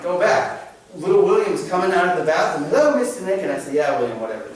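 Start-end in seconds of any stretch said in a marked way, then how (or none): 2.7-3.4 put-on little boy greeting. Rewind of the child greeting. 3.7-4.5 lower, bored brush-off; dismissive brush-off. Retold or imitated. What the boy heard from the teacher, a careless brush-off. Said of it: "That's what he saw".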